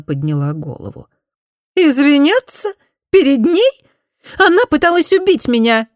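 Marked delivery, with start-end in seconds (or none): 1.8-5.9 much higher, loud indignant cry; outraged. Acted. Spoilt daughter furiously refusing to apologise to her cousin, high and loud. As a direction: fling it back in disbelief.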